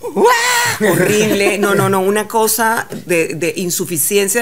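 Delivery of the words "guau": high mock wail, louder. Homesick girl arriving abroad, mock wail. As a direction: wail as if about to cry.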